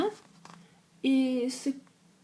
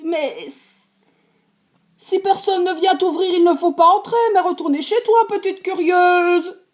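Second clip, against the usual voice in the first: mama bear scolding; 8 semitones higher, 7 dB louder than usual. Acted.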